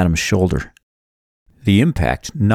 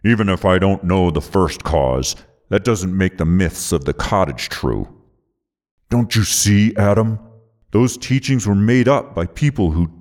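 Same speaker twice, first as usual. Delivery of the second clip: mildly dismissive villain voice; scoffing. A narrator's reading. Villain disputing the hero's beliefs, scoffing. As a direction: wave the argument away with light scorn. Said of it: "Alexander scoffed".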